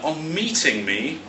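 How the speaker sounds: ordinary talk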